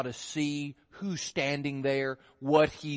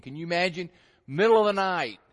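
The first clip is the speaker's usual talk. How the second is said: usual speaking voice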